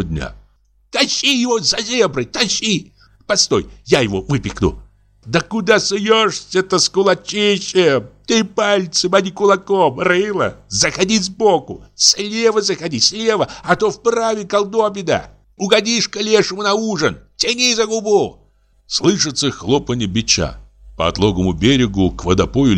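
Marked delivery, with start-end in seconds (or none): 0.9-4.8 very high, squeaky peasant voice; squealing tenor. A narrator's reading. Fisherman urging his mate to pull the fish, squealing. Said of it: "визгливый тенор". 5.3-18.4 very high, squeaky scolding; squealing tenor. A narrator's reading. Fisherman bossing his clumsy mate in the water, squealing. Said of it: "визгливый тенор".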